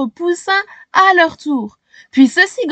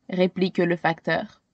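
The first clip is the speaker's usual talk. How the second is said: usual speaking voice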